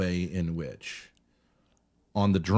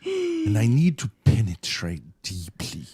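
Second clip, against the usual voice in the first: put-on German accent; 10 semitones higher than usual. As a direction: ponder each word like Herzog. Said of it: "Like Werner Herzog or something".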